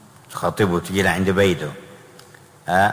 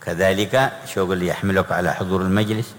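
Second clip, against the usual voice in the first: usual speaking voice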